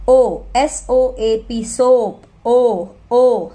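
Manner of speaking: slow spelled-out S ओ A P, then सोप and a long ओ, ओ; long o sound. Shown as a demonstration. Teacher showing the long ओ in सोप. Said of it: "ऐसे ही sound करेंगे".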